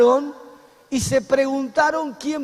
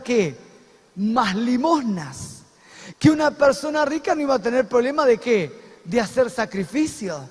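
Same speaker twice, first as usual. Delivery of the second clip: usual speaking voice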